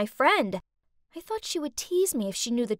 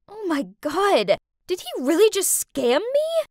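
louder and higher, disbelieving; shocked; 6 semitones higher, 5 dB louder than usual. Acted. Girl realizing her boyfriend scammed her, shocked. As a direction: gasp it out in shock. Learns she was scammed.

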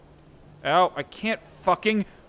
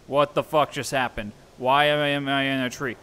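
ordinary talk

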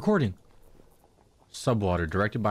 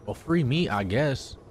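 normal talking voice

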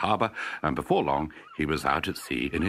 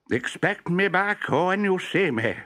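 cheery call; 13 semitones higher, 3 dB louder, slower than usual. Acted. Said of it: "he called".

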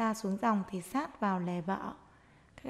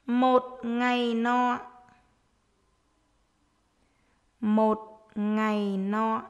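dictating; slower than usual. Shown as a demonstration. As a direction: dictate word by word to young pupils. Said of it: "hãy nghe cô giáo đọc để chúng mình viết".

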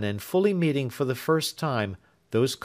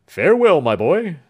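loud, higher booming goodbye; hearty farewell; 6 semitones higher, 10 dB louder than usual. Acted. Old doctor bids the young man farewell, loud and hearty. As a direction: send him off heartily.

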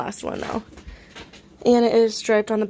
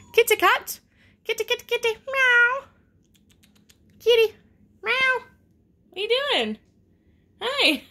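squeaky cooing with little meows; pet-talk voice; 13 semitones higher than usual. Real, not acted. Seller breaks off to her kitten, very high pet talk.

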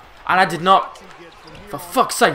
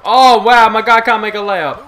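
loud, higher mocking disbelief; incredulous complaint; 4 semitones higher, 11 dB louder than usual. Real, not acted. Gamer mocking his player's missed layup, loud incredulous scoff. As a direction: scoff loudly at the miss.

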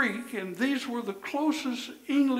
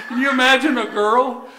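laughing; 6 semitones higher, 14 dB louder than usual. Real, not acted. Laughing at the joke.

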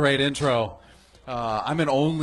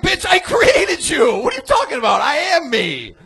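furious mock outburst, much higher and louder; outraged shout; 9 dB louder than usual. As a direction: scream at her that you made her. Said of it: "I'm like".

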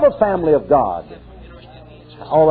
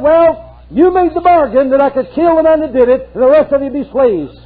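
high, raised voice of Joseph laying down terms; Joseph speaking; 11 semitones higher, 4 dB louder than usual. Retold or imitated. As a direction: voice the ruler's stern bargain, lifted high. Joseph voiced in retold story.

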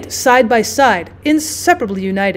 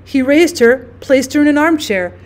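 ordinary delivery

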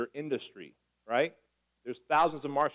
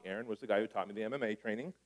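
normal talking voice

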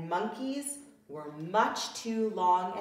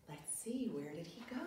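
plain reading voice

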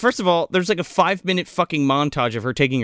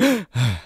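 mock startled gasp; 2 semitones lower than usual. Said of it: "just, like".

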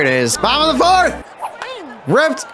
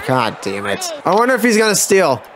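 ordinary talk, nothing marked

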